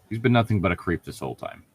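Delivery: ordinary talk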